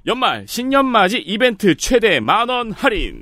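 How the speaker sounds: slow, high pitched sales pitch; infomercial. Shown as a demonstration. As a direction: read it like a TV ad. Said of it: "저는 이제 톤으로 알려드리는 거죠".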